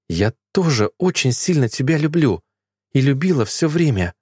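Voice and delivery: ordinary delivery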